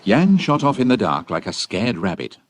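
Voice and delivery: ordinary talk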